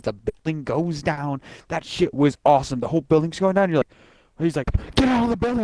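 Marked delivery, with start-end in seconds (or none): 5.0-5.6 high urgent mock shout; retold yell. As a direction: call it out like a soldier in a collapsing building. Retold game character yelling.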